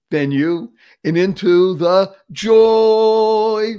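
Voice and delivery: "joy" held long and slow; stress on joy. Acted. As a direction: savor "joy". Stretches joy.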